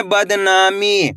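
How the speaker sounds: बदनामी said slowly and drawn out; slow blended reading. Shown as a demonstration. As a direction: model बदनामी slowly for the class. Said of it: "कैसे पढ़ेंगे?". (धीरे-धीरे बदनामी)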